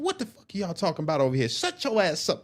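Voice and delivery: high, fast mock telling-off; imitated scolding. Female staff sergeant checking inmates like her sons, high-pitched scolding. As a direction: mimic a sergeant checking everyone, pitch climbing. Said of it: "she checked everybody".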